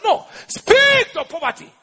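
high-pitched, drawn-out correction; emphatic command. Acted. God correcting the one praying, high emphatic command. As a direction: stretch it out high as a firm order.

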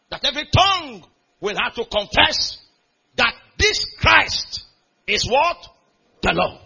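loud, high preached climax; preaching at full force. Acted. Preacher to congregation, loud building proclamation. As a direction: drive it home to the crowd.

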